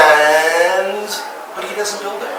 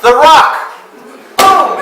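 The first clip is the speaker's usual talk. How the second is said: high, loud triumphant burst; excited reveal; 10 semitones higher, 6 dB louder, slower than usual. Real, not acted. Guide revealing the answer to his tour group, excited shout. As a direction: announce the answer like a punchline.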